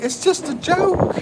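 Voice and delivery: high, jokey falsetto protest; mock innocent. Retelling his own joke to the wife in a high mock voice. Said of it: "I'm like".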